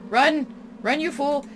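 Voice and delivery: loud urgent shout to flee. Real, not acted. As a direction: yell at yourself to run. Fleeing the creature.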